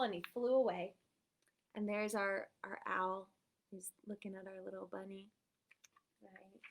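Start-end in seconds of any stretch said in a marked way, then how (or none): none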